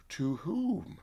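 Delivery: high, stunned gasp of a question; shocked question. Retold or imitated. Woman hearing her crush is marrying, stunned. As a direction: caught off guard by the news. Shocked by his marriage news.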